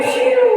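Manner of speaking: high whooshing car noise; a car whizzing past, high. Retold or imitated. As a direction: mimic a car zipping by.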